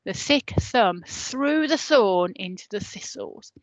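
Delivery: slow, leaning on every th sound; alliteration stressed. Shown as a demonstration. Teacher demonstrating the th alliteration, slow and stressed. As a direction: draw out each th for the children. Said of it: "So this one".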